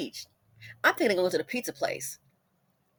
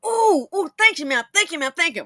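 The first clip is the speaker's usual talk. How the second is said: high, loud eager thanks; excited kids; 8 semitones higher, 8 dB louder than usual. Retold or imitated. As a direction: gush thanks like thrilled boys. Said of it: "They were too excited".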